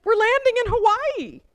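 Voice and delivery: wife telling her husband why she cries, overjoyed; teary joyful outburst. Retold or imitated. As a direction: blurt it out through happy tears. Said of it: "I burst into tears".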